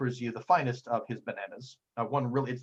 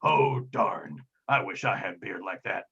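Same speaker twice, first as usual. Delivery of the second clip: low, gruff character voice, disappointed; deep-voiced tough guy, let down; Gruff Guile; 6 semitones lower than usual. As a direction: drop deep and grumble with envy. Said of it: "And Gruff Guile goes".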